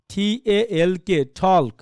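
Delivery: wrong "talk" with every letter voiced, L included. Shown as a demonstration. Teacher showing a letter-based reading, "talk" with L pronounced. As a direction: spell T-A-L-K slowly, then "talk" with L sounded. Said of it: "এমন উচ্চারণ হবে".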